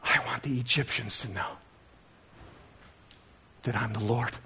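low, soft, solemn declaration; voice of God. Acted. God wanting the Egyptians to know him, solemn and soft. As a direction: drop down low, pausing before the last phrase. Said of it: "he goes on".

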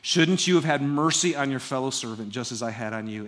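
usual speaking voice

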